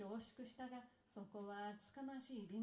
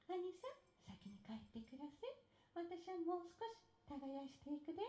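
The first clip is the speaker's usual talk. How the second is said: put-on lightly higher young wife; 4 semitones higher, 4 dB quieter than usual. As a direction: answer sweetly like a diligent wife. Wife answers husband.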